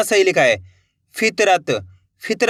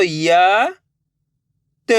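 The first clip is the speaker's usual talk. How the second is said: slow syllable demo; 2 semitones lower than usual. Shown as a demonstration.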